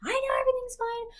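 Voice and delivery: high, loud tearful shout; retold outburst, high and loud, near tears; crying shout. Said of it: "And I was like".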